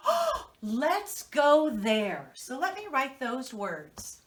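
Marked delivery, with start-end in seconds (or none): 0.1-2.3 slow, louder eager plea; drawn out, longing for lunch; eager suggestion. Retold or imitated. Wife urging husband to stop, slow and eager. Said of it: "I said to my husband".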